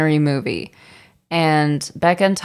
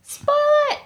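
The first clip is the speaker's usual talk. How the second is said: excited. Real, not acted. Cry to spoil the film.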